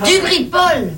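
répétition étonnée, haute et appuyée; pharmacienne surprise. Acted. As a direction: reprendre la demande en s'exclamant, dans l'aigu. Pharmacienne réagit à la demande cliente. (exclamation étonnée de commerçante)

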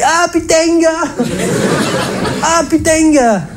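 very high, hammed-up southern French exclamation; Provençal accent. Doctor from Aix announces cancer to his patient, broad Provençal accent. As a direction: sing it out in a broad Provençal accent with heavy final syllables. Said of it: "avec sa bonne trompe méridionale".

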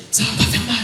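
soft whisper in the ear, unvoiced; hushed doctor's promise; whispered reassurance. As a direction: breathe it close to his ear.

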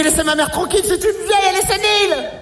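beaucoup plus aigu, crié; défendre sa mère en criant. Acted. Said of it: "Je lui dis". (cri aigu indigné)

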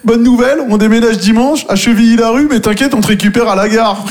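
high, bouncy fake-upbeat phone call; mock cheery friend on the phone. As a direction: sell bad news as good news, cheerily. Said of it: "Eux, ils t'appellent".

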